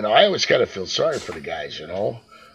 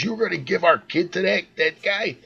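higher, hushed incredulous scolding; mock indignant wife, pitched up; pissed-off wife; 6 semitones higher, 4 dB quieter than usual. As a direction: imitate a wife scolding in disbelief. Retells angry wife's scolding.

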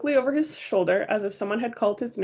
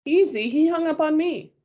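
bitter retort; 8 semitones higher, 4 dB louder than usual. Acted. Jacob's angry reply in story.

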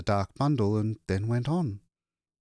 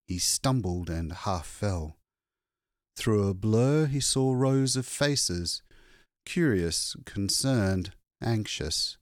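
ordinary delivery

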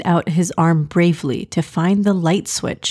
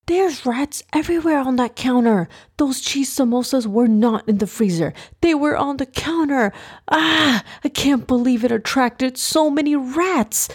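high-pitched panicky rambling; panicked; 6 semitones higher than usual. A narrator's reading. Ibrahim to himself about rats on the counter, panicked. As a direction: fret aloud in a raised, alarmed pitch.

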